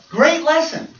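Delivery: high, loud mock compliment; hearty praise. Churchgoer to preacher at the door, imitated hearty praise. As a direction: imitate a gushing handshake at the door.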